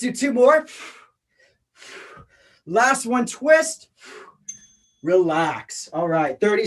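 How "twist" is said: usual speaking voice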